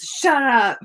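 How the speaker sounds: loud, high-pitched retort; mock yell. Retelling a girl heckler's comeback on a live stream, mock yell.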